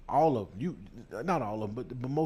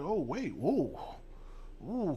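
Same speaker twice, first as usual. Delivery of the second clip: mock alarm; 5 semitones higher, much slower than usual. Imitating panicked outsiders.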